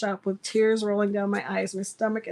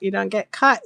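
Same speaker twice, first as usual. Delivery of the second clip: ordinary delivery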